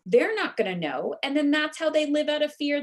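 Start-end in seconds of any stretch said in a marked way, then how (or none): none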